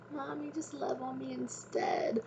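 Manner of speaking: mother voicing her pleading little girl; little daughter. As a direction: imitate a toddler begging for cuddles. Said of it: "she's just like".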